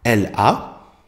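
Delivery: "L A" spelled letter by letter; naming each letter of "L A" separately. Shown as a demonstration. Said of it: "On écrit là".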